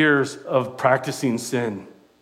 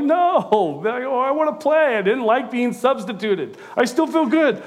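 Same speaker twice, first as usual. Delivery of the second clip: high, pleading mock whine; pleading player; 10 semitones higher than usual. Young player begging his coach to stay in, high mock protest. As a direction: beg to stay on like a stubborn kid. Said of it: "I'm like".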